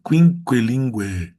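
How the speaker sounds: Quinquelingue slow and loud, every U heard as w; all Us sounded. Shown as a demonstration. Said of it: "diz todos os Us".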